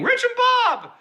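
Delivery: high-pitched comic cry; mock-gleeful outburst; shouted catchphrase. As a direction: sing out the catchphrase as an answer, way up high. Answers imagined question with catchphrase.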